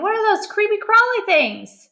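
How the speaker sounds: high, rising alarm; mother alarmed by the bugs. A narrator's reading. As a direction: recoil from the bugs. First reading, alarmed.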